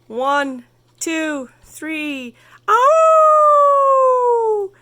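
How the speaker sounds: a count that ends in a very high, loud "ow!"; counting, then a yelp. Acted. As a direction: count off, then cry out suddenly. Coyote starts the race.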